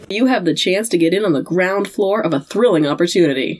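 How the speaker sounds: ordinary talk, nothing marked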